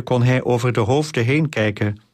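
plain reading voice

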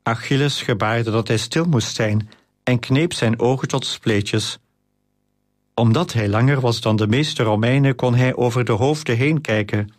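plain reading voice

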